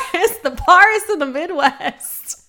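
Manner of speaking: "Paris" with a broad AH, PAHR-is, through laughter; Midwestern accent. Shown as a demonstration. Caught saying the city name wrong, Midwestern "Paris" with AH. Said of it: "Did you just say Paris".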